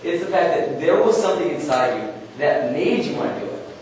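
ordinary talk

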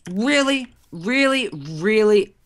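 high, incredulous repeated question; indignant disbelief. Real, not acted. Gamer reacts to being teamed on, high indignant disbelief. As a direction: protest it, starting high and easing down. Just killed by teaming players.

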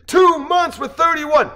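high, loud, slow roar; crowd shout. Acted. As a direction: answer the call like a rally crowd. Crowd answers the speaker's call.

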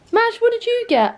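very high-pitched cooing; cooing question. Pretend. Vlogger asks Madge about the advent calendar, high cooing. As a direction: pitch it right up, as if to a pet.

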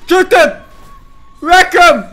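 loud high hype shouts; excited shouting. Real, not acted. Hyping in fight.

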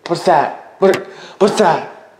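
startled, rising panic; alarmed. Real, not acted. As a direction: demand it, suddenly frightened. Hears a noise in the dark.

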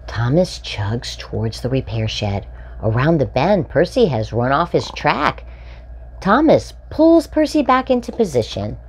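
bright, lively storybook read-aloud; animated children's story reading. A narrator's reading. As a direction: read it like a picture book for little ones. Reading picture book to children.

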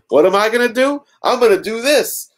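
high, hammed-up defiance; defiant comeback. Lois answers Luthor before snatching the phone, mock defiant.

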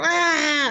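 high-pitched drawn-out "aah"; reenacted scream of a terrified rider; mock scream. Storyteller being dragged at ninety miles an hour, mock scream. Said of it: "so I'm screaming".

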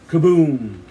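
loud imitated blast; booming falling kaboom; storyteller's sudden loud boom; bomb explosion. A narrator's reading. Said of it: "Another bomb exploded".

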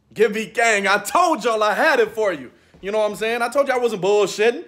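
loud, high victory gloating; triumphant shouting. Real, not acted. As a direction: crow loudly about the win. Celebrating the win loudly.

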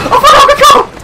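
usual speaking voice

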